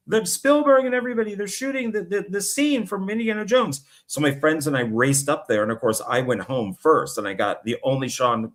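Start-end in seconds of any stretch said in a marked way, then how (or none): 0.1-3.8 excited shout. Retold or imitated. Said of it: "somebody came in and goes".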